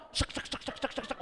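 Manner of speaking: breathy sek sek, ticking fast; imitated counting sound. Pastor mimicking the ATM counting out money. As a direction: mimic the machine counting notes.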